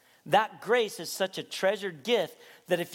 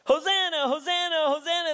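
high, loud repeated chant; shouting like a broken record; 12 semitones higher, 7 dB louder than usual. Retold or imitated. Screaming kids in the temple, shouting praise on repeat. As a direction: shout it over and over, much higher. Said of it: "They kept shouting like a broken record".

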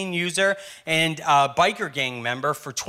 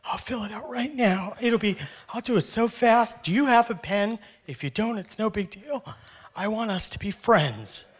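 eager to please; 6 semitones higher, 3 dB quieter than usual. Retold or imitated. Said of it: "I was like".

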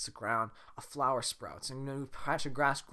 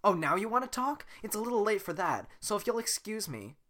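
Fiona voice; 9 semitones higher, 4 dB louder than usual. Acted. Fiona's retort.

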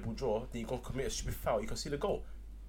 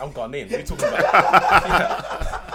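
louder, slow, laughing through it; laughing protest at being called English; laughing retort; 2 semitones higher, 14 dB louder, much slower than usual. Pretend. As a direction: laugh off the question. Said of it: "I'll be like".

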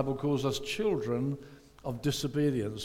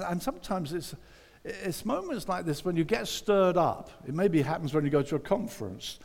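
ordinary talk, nothing marked

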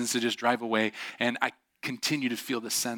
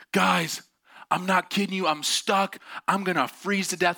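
desperate stranded man; 6 semitones higher than usual. Retold or imitated. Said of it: "He's like".

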